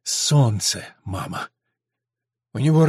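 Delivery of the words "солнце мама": low, hoarse answer; subdued low reply with some hoarseness. A narrator's reading. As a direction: drop the voice, a little husky. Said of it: "хрипло проговорил Дэн".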